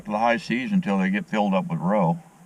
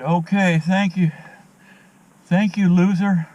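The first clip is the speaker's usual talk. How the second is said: imitating the other man; 10 semitones higher, 4 dB louder than usual. Man on trail saying goodbye.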